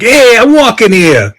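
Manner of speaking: loud, high Brooklyn street yell; a New York guy bellowing at a cab in a Brooklyn accent. Retold or imitated. Pigeon imitated as a loud Brooklyn New Yorker. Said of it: "sound like it was from Brooklyn".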